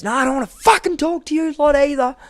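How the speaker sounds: imitated high, loud angry yell; going ballistic. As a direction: yell it high and hard, acting out someone's outburst. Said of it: "he's going ballistic".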